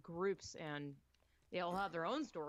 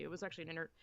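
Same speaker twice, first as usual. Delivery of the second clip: ordinary talk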